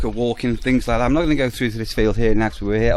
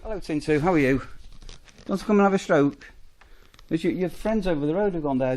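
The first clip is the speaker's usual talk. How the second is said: greeting a horse; 6 semitones higher than usual. Real, not acted. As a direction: coax the horse over for a stroke.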